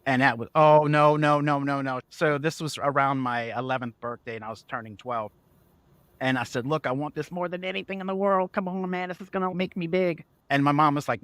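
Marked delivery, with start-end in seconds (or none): 0.5-2.0 loud string of motherly no's; loud mock parental veto; imitated refusal. Mother refusing the book, acted. 6.7-10.2 put-on higher-pitched kid begging mom; mock boyish begging; imitated pleading. Child begging mother for book.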